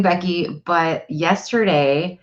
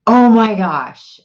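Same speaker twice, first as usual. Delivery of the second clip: loud, high, drawn-out gasp of praise; animated amazement; 6 semitones higher, 7 dB louder, slower than usual. Retold or imitated. Mother amazed at her five-year-old spelling, animated amazement. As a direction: exclaim it big, slow and high. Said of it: "'cause I'm so animated".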